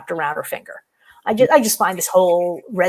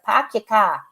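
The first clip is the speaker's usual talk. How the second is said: Boston "pack your car", R's dropped; R-less Boston demonstration; Boston accent; slower than usual. Shown as a demonstration. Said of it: "Just drop the R's".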